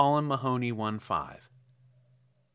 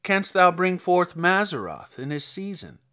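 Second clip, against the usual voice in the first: measured, solemn King James reading, slightly raised; scripture reading; 5 semitones higher, 4 dB louder than usual. Acted. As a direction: read it like a solemn Bible passage. Reads Job chapter aloud.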